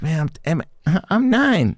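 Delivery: put-on high squeaky child protest; mock little kid. Kid telling older boy his age, squeaky. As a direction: squeak it like a nervous nine-year-old.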